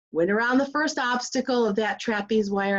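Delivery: usual speaking voice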